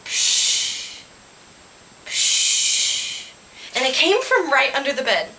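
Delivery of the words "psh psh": long hushing "psh"; drawn-out rush of breath, no voice; strange noise. A narrator's reading. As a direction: make a mysterious hissing noise. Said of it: "It sounded like".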